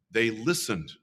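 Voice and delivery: ordinary delivery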